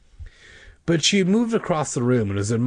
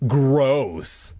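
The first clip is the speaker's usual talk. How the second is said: drawn-out acted revulsion; grossed-out exclamation; disgusted; 3 semitones higher, 4 dB louder than usual. A narrator's reading. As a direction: groan the word in disgust. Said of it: "She made a disgusted face".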